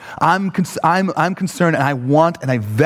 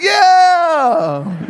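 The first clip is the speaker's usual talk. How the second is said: high, wailing shout; hammed-up high yell; desperate cry; 13 semitones higher, 3 dB louder than usual. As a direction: cry it out in mock relief. Mock cry of desperate runner.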